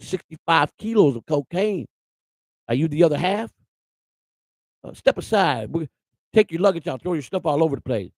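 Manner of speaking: imitating a sneering Southern deputy; Southern sheriff. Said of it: "That's how they talk to you".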